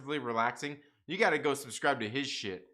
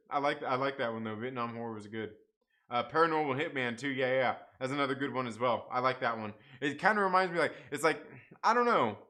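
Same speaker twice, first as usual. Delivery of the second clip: ordinary talk, nothing marked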